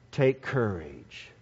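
low, soft and slow Take courage; Jesus quietly comforting the disciples; soft reassurance. Acted. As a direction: murmur Take courage gently.

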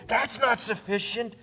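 usual speaking voice

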